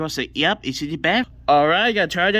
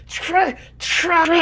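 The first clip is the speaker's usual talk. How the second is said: straining effort; 8 semitones higher, much slower than usual. Acted. Straining to do something.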